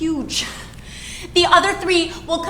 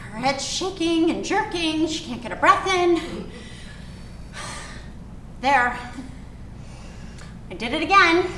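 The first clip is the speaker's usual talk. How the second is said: plain reading voice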